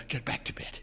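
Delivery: shaky, breathy whisper; unsteady. A narrator's reading. Husband trying to calm his wife, whispering unsteadily. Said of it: "he said unsteadily".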